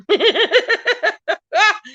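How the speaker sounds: a long, loud high cackle in bursts; huge high cackling laugh. Real, not acted. Host proving how loud her laugh is with a long cackle. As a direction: laugh big, from the voice box.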